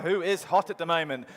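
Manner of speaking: ordinary delivery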